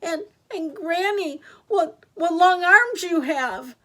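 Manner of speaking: put-on high, hesitant little girl; distressed. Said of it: "was distressed".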